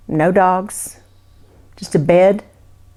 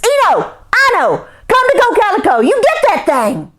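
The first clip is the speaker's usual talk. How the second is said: high, loud urgent call; calling the dogs; 13 semitones higher, 5 dB louder than usual. Acted. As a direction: send the dogs out after it. Jake calls out to his dogs.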